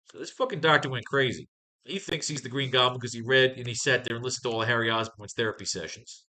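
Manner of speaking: ordinary delivery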